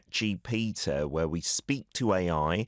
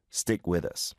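ordinary talk

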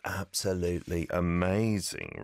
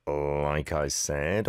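normal talking voice

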